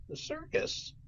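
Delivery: breathless, whispered disbelief; surprised duck. Acted. The duck hears the job is at the circus, whispering. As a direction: gasp it out in quiet surprise.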